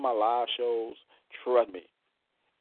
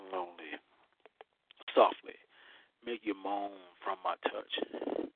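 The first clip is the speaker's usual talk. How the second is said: sultry poem reading; 6 semitones lower, 6 dB quieter than usual. Acted. Reads his erotic poem.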